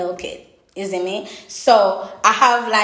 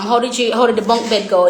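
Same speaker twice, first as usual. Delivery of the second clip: usual speaking voice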